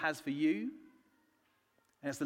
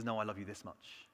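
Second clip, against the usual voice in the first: softer, tender voice of Jesus; hushed tender reply; 8 semitones lower, 10 dB quieter than usual. Retold or imitated. Jesus answering the little girl, soft and tender. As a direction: let it fall soft and tender.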